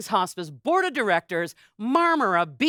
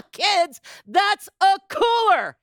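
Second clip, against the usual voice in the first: playful reveal; 7 semitones higher, 3 dB louder, slower than usual. Real, not acted. Joking reveal of the cooler.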